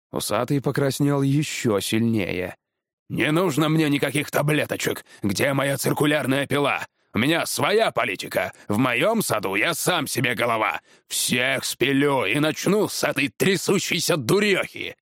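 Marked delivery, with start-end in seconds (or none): 3.1-15.0 blustering, angry tree feller; irate husband. Acted. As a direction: rant and threaten, without shouting. (разгневанный муж)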